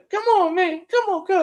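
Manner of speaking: very high whining complaint; whiny player voice. Retold or imitated. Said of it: "high-pitched voice and whining".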